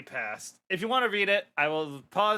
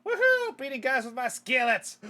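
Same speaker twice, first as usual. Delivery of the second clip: high, excited cheer; whooping cartoon fighter; gleeful battle whoop; 7 semitones higher, 4 dB louder than usual. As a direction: revel in the fight, high-pitched. Skillet fighter revels in battle.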